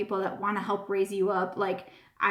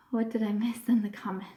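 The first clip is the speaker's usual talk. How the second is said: ordinary talk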